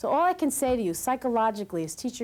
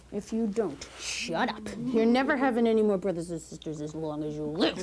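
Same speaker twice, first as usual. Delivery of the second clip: a hint of a fed-up parent; 3 semitones lower than usual. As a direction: drag it out like a worn-out parent. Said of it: "kinda say".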